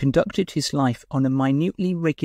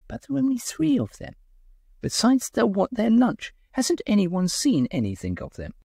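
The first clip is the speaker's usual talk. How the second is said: high, fretful hostess voice; lightly voiced worried lady; fussing hostess; 8 semitones higher than usual. A narrator's reading. Lady Blonze fretting about missing guests, high and fussy.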